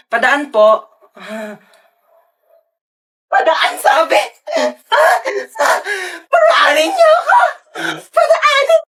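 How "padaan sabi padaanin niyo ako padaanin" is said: very high, shrieking tearful outburst; comic screaming meltdown; hysterical demand. Acted. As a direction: screech to be let through. Demands to pass, loses it.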